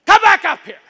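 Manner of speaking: high, loud summons up the driveway; man at his door calling the kid back, loud call. Retold or imitated. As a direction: call the boy back sternly.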